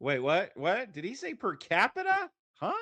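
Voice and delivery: rising, high-pitched incredulity; mock disbelief. Mock shock at debater's phrase.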